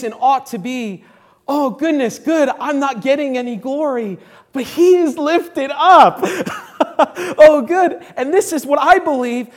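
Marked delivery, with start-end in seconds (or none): 1.5-8.0 joyful laughing cry. Acted. Acting believer's joyful cry.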